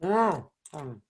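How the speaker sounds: talking with a mouthful of taco; chewing while talking; mouth full. Real, not acted. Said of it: "[name] is the one talking with his mouthful".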